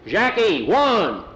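loud, high summoning shout; calling out. A narrator's reading. Pedro summoning the two boys, calling out. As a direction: holler both names across the yard. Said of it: "they heard the voice".

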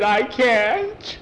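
high, loud wail; pained protest. Acted. Skeeter refuses Tom's order to sit, pained protest.

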